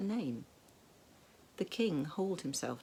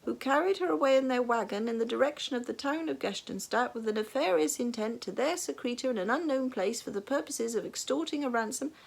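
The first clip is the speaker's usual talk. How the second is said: loud, high sergeant's report; high volume; 7 semitones higher, 4 dB louder than usual. A narrator's reading. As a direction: keep up the pompous loud recital. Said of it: "continued the sergeant at high volume".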